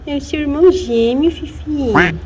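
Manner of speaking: ordinary talk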